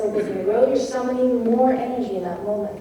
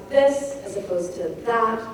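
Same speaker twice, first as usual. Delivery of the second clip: this pushed out with stress, that left light. Shown as a demonstration. Lecturer stressing this over that. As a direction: lean on the word this. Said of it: "when we emphasize".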